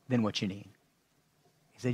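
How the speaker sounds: normal talking voice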